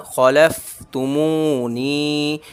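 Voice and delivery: very slow खलफ तुमू नी, खलफ clipped; slow recitation; stretched मू, नी. Shown as a demonstration. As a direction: keep खलफ short, hold मू and नी. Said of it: "ना खा खींचेगा ना लाम खींचेगा".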